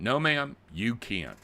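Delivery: mock yell. Fake officer yelling at grandma to refuse her request. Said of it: "Now they're yelling at you".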